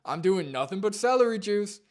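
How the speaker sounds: mock his proud diet announcements; mocking imitation. Parodying the video maker's diet claims.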